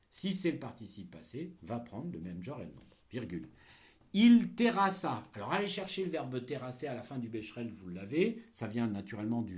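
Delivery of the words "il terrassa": slow, loud, high dictation; dictating. Shown as a demonstration. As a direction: dictate it slowly and loudly. Dictation of text chunk.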